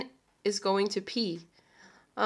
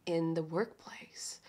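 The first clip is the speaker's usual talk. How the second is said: whispered; 4 semitones lower, 12 dB quieter, slower than usual. Acted. Teasing dark stories.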